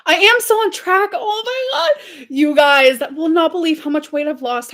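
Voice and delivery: high, loud, gushing excitement; bursting to share good news; real excitement, voice way up and loud; excited squeal. Real, not acted. Excited weight-loss announcement on live.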